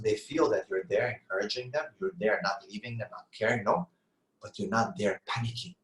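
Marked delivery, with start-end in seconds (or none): none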